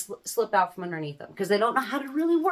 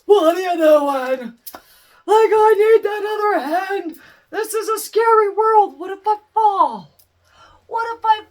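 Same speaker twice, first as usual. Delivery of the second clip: put-on scared toddler; 9 semitones higher, 11 dB louder than usual. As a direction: plead like a frightened little kid. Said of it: "that child's like".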